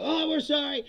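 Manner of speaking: high, loud sheepish sorry; mock apology. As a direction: cave in like a scared government. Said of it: "and then they go".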